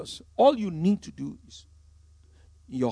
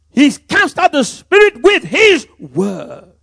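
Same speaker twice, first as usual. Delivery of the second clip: slow, much higher, far louder proclamation; emphatic proclamation; 8 semitones higher, 17 dB louder, much slower than usual. Real, not acted. Preacher proclaiming to the church how Jesus cast out spirits: slow booming emphasis. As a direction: hammer each word home to the congregation. Repeated as climax.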